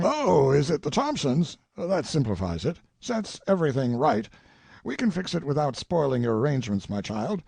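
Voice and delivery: put-on old colonel sizing up a face, mildly excited; lightly voiced old colonel. A narrator's reading. Colonel to Tracy about a portrait, lightly voiced old man. Father insists on daughter's plans.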